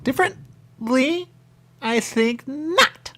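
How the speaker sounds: high, slow, drawn-out snooty retort; mock haughty reply. Quoting him mocking the word, high and drawn out. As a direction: stretch it out, loud and high, like a prim snob. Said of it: "He said".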